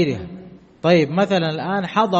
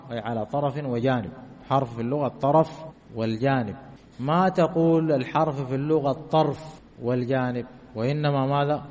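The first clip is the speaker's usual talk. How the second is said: normal talking voice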